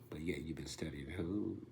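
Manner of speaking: breathy whisper, leaning in close; whispered needling question; whispered taunt. Acted.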